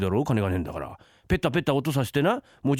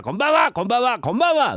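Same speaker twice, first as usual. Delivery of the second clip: loud, high greeting fired off repeatedly; insistent calling; 9 semitones higher, 6 dB louder than usual. Pretend. Husband pestering wife as pretend visitor, calling out insistently. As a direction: pester the house with hails.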